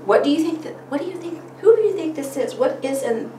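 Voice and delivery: a touch of curious parent voice; prompting a child. Shown as a demonstration. Parent asking a child about the picture, lightly curious. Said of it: "And as I said".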